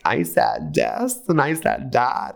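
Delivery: high, slow mock Perez; Perez Hilton impression. Host mocking Perez Hilton to Victoria in a high drawl. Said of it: "he's like".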